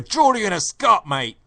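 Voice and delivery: high, loud mock East London; hacky East London accent. Scoffing about Geordie and Scot mix-up, hacky East London accent. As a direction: put on a hammy East London voice. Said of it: "hacky East London thing that I always do".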